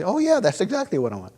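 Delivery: light mock delight; delighted customer. Woman customer agreeing with the salesman, pleased.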